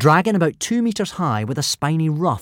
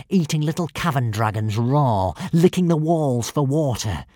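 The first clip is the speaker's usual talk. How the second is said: villain voice; 4 semitones higher, 4 dB louder than usual. Acted. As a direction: relish the grim tale. Alvin's villain tale.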